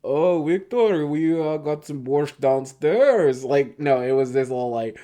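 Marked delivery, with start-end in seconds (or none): 0.0-3.4 slow, higher hammed-up Russian accent; mock Russian goon. Podcaster imagines the goons going fully Russian, mock Russian accent. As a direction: play a cartoon Russian henchman, slowly. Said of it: "embraced the Russian thing and just, like, all Russian".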